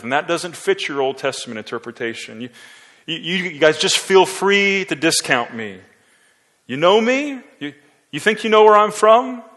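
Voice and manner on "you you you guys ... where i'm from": loud, high, mocking challenge; sarcastic question. Shown as a demonstration. Jesus challenges doubters, high loud sarcastic question. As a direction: throw it back at them as a question. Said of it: "I happen to think it should be a question".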